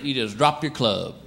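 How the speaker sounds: normal talking voice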